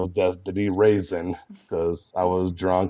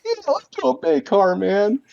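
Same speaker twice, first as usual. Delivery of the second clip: much higher eager nerdy voice; Nick Riley voice; 3 dB louder than usual. Acted. Nick Riley agrees to a bait car with Wiggum, high eager nerdy voice. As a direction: say yes like a thrilled nerd. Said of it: "It's the voice".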